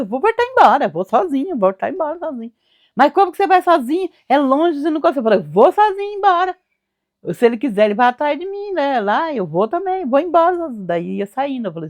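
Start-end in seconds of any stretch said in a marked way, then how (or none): 2.9-5.2 bem mais agudo e rápido, preocupado; mãe aflita. Retold or imitated. 5.4-10.7 insistência mais aguda e alta; teimosia aguda. Retold or imitated. Teimando em ir sozinho.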